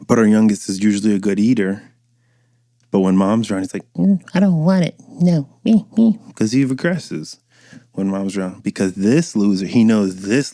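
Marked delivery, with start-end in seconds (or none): 3.9-6.2 put-on much higher, whining little-kid voice; whiny toddler. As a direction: whimper like a spoiled toddler. Youngest refusing food with mom.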